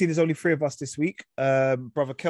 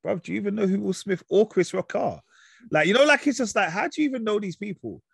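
higher, exasperated disbelief; 7 semitones higher than usual. Retold or imitated. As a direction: act baffled at the question. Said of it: "I'm like".

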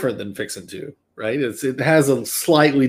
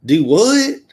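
Texas drawl, "Do what?" rising on "what"; Texan "Do what?"; 6 semitones higher, 5 dB louder than usual. Shown as a demonstration. Host demos a Texas phrase, puzzled Texan accent. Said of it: "Texas was".

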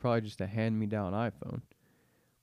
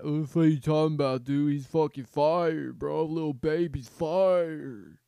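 mocking hyped kid; 5 semitones higher, 8 dB louder than usual. Kids defend their favorite rapper, loud mock fanboy voice. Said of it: "they're just like".

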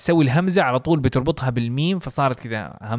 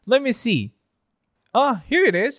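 bright, high airline clerk; friendly check-in agent greeting a passenger; customer service voice; 6 semitones higher, slower than usual. Acted. Airport clerk role again.

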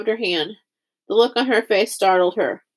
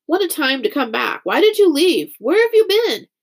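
high, louder pressing questions; excited questioning; 7 semitones higher, 4 dB louder than usual. A narrator's reading. Friends question the girl who came back, excited questioning. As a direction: crowd firing urgent questions, rising in pitch.

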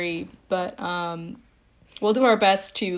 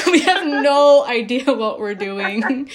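laughing; 5 semitones higher, 7 dB louder, slower than usual. Real, not acted. Laughing at being new.

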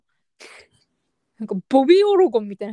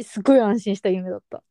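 ordinary talk

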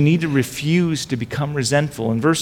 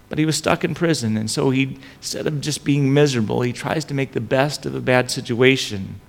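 normal talking voice

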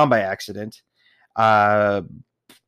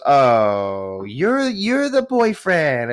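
much higher, slow, drawn-out mom voice; mom recognizing him; 4 semitones higher, 3 dB louder, much slower than usual. Retold or imitated. Her mom finally placing who he is, high drawn-out. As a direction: stretch it out like a surprised mother. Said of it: "And she goes".

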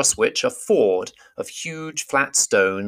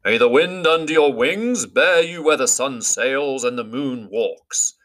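solemn wizard, higher, slowing; measured ceremonial reply, wind slows; Gandalf formal reply; 5 semitones higher than usual. Acted.

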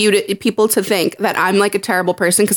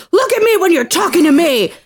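higher-pitched mock yell; retold scream; 7 semitones higher than usual. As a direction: imitate an angry scream, half-voiced. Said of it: "Just screams it".

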